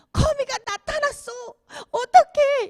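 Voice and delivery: put-on high, frightened little child's voice; scared child. Child who saw a spider on the ceiling, frightened and high. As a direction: whimper it like a kid who just saw a spider. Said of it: "막 펑펑 우는 거예요".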